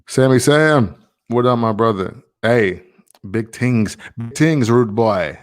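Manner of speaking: put-on a clumsy try at a Jamaican accent; terrible Jamaican accent. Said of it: "That was probably a terrible Jamaican accent".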